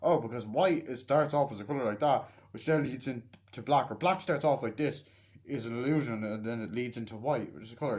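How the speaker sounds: ordinary talk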